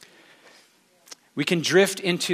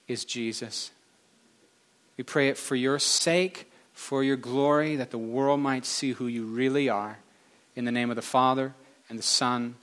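measured, subdued closing prayer; spoken prayer. Real, not acted. Preacher blessing this little tribe, prayerful. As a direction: address God in steady phrases, easing down at each close.

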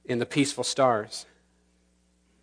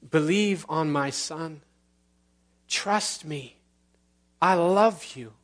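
higher, slow, earnest voice of God speaking; God inviting the listeners to trust him, higher and earnest; 6 semitones higher than usual. Acted. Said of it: "This is the loud voice".

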